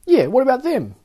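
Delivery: a high, loud jeering echo; mocking echo. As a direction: TS backs up her partner, high and jeering. TS chimes in angrily.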